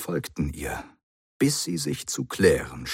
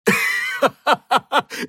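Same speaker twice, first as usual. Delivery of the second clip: loud high burst of laughter; ringing laughter; 6 dB louder than usual. Acted. The Doctor bursts out laughing at his mistake. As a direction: break into roaring laughter. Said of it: "in schallendes Gelächter ausbrach".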